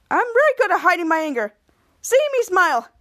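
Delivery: acted anger posing as composure; hiding anger. Henry claiming to hide his anger, badly masked. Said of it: "but angrily".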